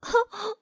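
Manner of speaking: high delighted little laugh; a quick gleeful giggle; happy child giggle. A narrator's reading. Fei'er giggling happily on his father's shoulders. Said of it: "菲儿高兴地接着说".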